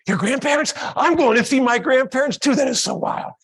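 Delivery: put-on high, loud, amazed child; thrilled child imitation at full volume; excited kid. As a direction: gush like a kid finding a match. Acts child thrilled by shared plans.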